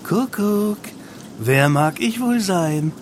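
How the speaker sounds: high, slow, drawn-out taunt; teasing singsong. A narrator's reading. Reggie toys with the leader in the dark, teasing. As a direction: play a mocking guessing game. Reggie teases during the roll call.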